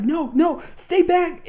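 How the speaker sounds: high, loud panicked cry to keep someone away; panicked screaming. Retold or imitated. Captured Cuttlefish screams over the radio, high and loud panicked. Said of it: "screaming and panicking".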